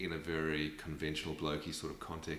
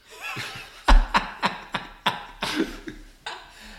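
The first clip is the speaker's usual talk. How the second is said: a loud, breathy burst of laughter; big laugh; 10 dB louder than usual. Real, not acted. Telling a friend a funny story, a loud real laugh.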